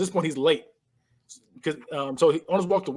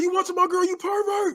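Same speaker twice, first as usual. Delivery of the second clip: high-pitched comic confrontation; hammed-up squeal of outrage; mock outraged accusation. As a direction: play a jealous boyfriend, pitched way up. Retold angry boyfriend confronting him.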